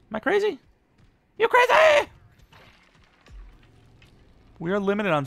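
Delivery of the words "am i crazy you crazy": very high squeaky voice rising to a loud squeal; hammed-up falsetto outburst; mock crazed squeal. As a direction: squeak it, then shriek it playfully. Jokingly doubts self, squeals.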